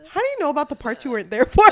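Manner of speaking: fast and loud through laughter; laughing question. Real, not acted. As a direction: giggle while asking. Laughing at plot hole.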